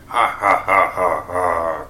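slow, villainous cackle; evil cackle. A narrator's reading. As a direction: cackle like a demon. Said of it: "cackled the demonic Alex Jones".

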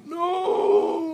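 loud, very high crying scream; a hysterical wail as the dirt falls. Acted. Frank buried alive, screaming hysterically as dirt falls. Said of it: "Frank hysterically".

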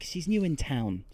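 ordinary talk

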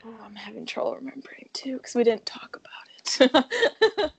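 hushed, breathy aside; whisper. Real, not acted. Whispering to her co-host that she forgot last week. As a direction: whisper it like a confession.

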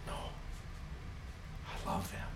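slow breathy hush; tender whisper. Acted. God loving his people, slow tender whisper. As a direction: whisper it with love.

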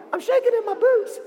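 very high, squeaky mock-scared voice; scared Israelites. Shown as a demonstration. Storyteller teaches kids the Israelites' line, mock terror. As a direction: quail in a comic falsetto. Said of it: "they were so scared".

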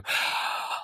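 soft unvoiced gasp of relief; a quiet breath of satisfaction after a good day; contented gasp. Parent's contented breath after bedtime.

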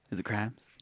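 low, hushed, secretive ask; hushed, leading question; soft teasing question. Shown as a demonstration. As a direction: ask it quietly, baiting the dog. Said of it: "go ahead and say".

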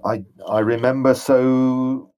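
hesitant, haunted memories; a dazed ghost struggling to remember; ghostly general, troubled. Acted. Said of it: "And he says".

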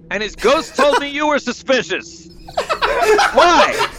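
laughing through a hammy accusation; breaking into laughter mid-line; laughing in character. Acted. Character confronting priest, laughing.

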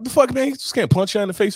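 high-pitched incredulous protest; retold outrage. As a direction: replay your shocked objection, pitch jumping up. Acts out his shocked objection.

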